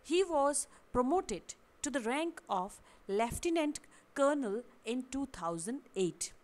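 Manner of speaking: slow example read. Shown as a demonstration. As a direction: read out slowly and clearly as an example sentence. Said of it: "अगला sentence". (धीरे और साफ़ उदाहरण वाक्य)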